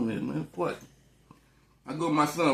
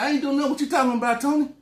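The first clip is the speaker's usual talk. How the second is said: lightly voiced woman; 10 semitones higher, 5 dB louder, faster than usual. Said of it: "She said".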